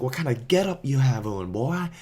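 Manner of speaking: mock him like an aggressive stranger; the stranger mocking the ghillie suit, a high sneering taunt; mock bully. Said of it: "So the guy's like".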